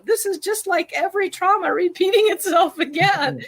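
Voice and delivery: high-pitched mock alarm; exaggerated catastrophizing. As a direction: overdramatize a spiralling thought, pitched well up. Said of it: "not really that extreme".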